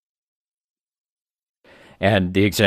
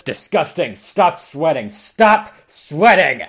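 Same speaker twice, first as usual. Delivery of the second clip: shrill rising rage, much higher and louder; high furious screech building to a roar; screaming Examiner; 10 semitones higher, 5 dB louder, much slower than usual. Acted. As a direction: scream it like a sadistic interrogator. Comic retells screaming Examiner.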